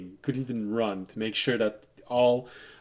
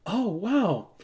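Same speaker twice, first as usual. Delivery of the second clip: elf voice pitched higher, coy and teasing; flirty elf voice; 9 semitones higher than usual. Retold or imitated. Elf teasing the party with a coy, higher voice. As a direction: play the elf, coyly loosening her shirt. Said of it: "you hear the elf go".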